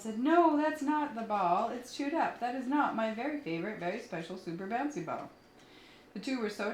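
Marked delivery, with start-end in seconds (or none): 0.2-5.3 upset dog voice. Acted. Lola upset about chewed ball.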